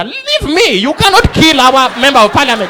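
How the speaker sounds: high-pitched angry protest; indignant brush-off. Acted. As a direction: cut the reporter off, loud and high. Witness brushes off the interrupting reporter.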